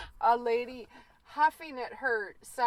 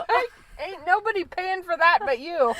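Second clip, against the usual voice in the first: sassy laughing retort; 7 semitones higher, 7 dB louder than usual. Retold or imitated. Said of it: "You're like".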